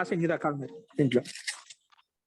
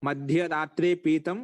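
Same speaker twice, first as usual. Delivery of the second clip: slow recitation; much slower than usual. Shown as a demonstration. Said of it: "teacher recites Sanskrit example".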